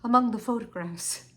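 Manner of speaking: voiced firmly after a hushed attempt; steeled restart. Wife forces herself to resume telling Henry, steeled. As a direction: make yourself say it, at normal volume.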